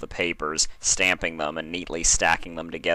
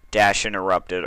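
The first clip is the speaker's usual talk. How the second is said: plain reading voice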